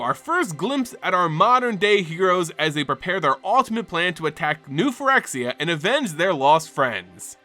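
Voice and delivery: usual speaking voice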